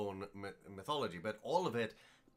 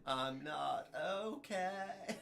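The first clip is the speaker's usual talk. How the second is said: mock emo; 8 semitones higher, much slower than usual. Emo lyric, said slowly.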